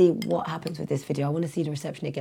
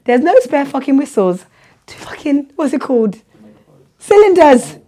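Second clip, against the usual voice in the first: high, loud exasperated rant; 11 semitones higher, 18 dB louder than usual. Retold or imitated. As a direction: vent at full volume. Teacher ranting in playground.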